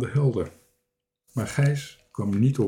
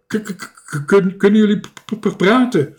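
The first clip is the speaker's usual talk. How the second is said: high, loud, startled stutter; stammering; 9 semitones higher, 6 dB louder than usual. A narrator's reading. Startled castaway hearing animals talk, stuttering. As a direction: stick on the first consonants in shock. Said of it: "stotterde hij".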